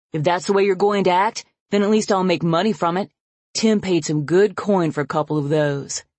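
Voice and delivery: loud, sneering tirade; father raging at his daughter with contempt; angry contempt. Acted. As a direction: spit the insults at her.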